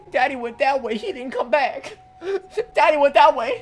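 high, laughing fake cry; fake-sob the line while cracking up; mock sobbing through laughter. Mock crying as Tails.

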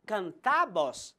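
canta said slowly with stress on the final TA; slow can-TA, landing hard on the end of canta; stress on last syllable. Shown as a demonstration. Teacher repeating the vos form, canta stressed on TA. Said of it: "la última sílaba sería la más fuerte".

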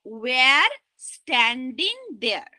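"were" said like "wear", very slow; mispronounced "were". Shown as a demonstration. Teacher giving the poorer example, slow "were" sounded as "wear". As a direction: slowly rhyme "were" with "there". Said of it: "তাহলে কোনটা ভালো?".

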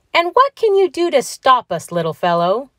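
high, teasing challenge; cocky amusement. A narrator's reading. Donald taunts the little man over his shovel, cocky amusement. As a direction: ask it cockily, talking down to him. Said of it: "asked Donald Duck with a chuckle".

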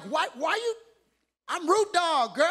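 playful brag to the wife; cocky swagger. Retold or imitated. Husband bragging to his wife, cocky swagger. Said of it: "And I'm like".

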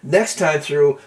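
normal talking voice

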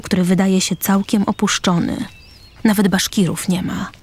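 usual speaking voice

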